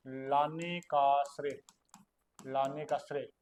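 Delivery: very slow, word by word, stressing का; slow dictation. Shown as a demonstration. Teacher to students taking notes, dictating very slowly. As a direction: drag it out so they can note it down. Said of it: "teacher dictating key phrase".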